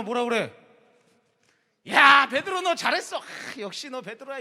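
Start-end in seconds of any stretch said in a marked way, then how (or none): 1.9-4.4 much louder, higher hammed-up praise; wrong version, gushing. Pastor faking the wrong gushing Jesus to Peter, hammed up. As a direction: overdo the praise on purpose. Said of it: "이게 아니라".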